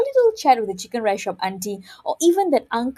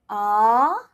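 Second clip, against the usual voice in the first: drawn-out AH with a rising tune; second tone; 5 dB louder than usual. Shown as a demonstration. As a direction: slide AH steadily upward. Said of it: "It has to go up because it's a rising tone".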